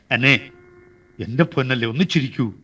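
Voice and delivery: soft, low coaxing; coaxing tenderly. Acted. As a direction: coax her gently to smile. Lover coaxes a smile.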